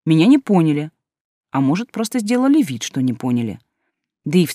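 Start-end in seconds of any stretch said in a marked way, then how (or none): none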